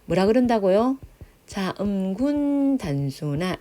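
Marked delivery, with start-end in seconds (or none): 1.5-3.6 자음군 단순화 stretched out slowly; slow term demonstration. Shown as a demonstration. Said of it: "이렇게 얘기합니다".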